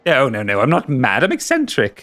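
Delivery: high, giddy creature delight; creepy giggly voice. Acted. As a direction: coo over him like a mad collector. GM in shopkeeper voice.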